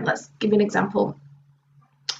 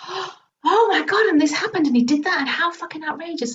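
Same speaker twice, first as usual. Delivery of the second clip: outraged venting; 7 semitones higher than usual. Retold or imitated. Client finally venting about the ex, high and outraged. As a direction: let the outrage spill out.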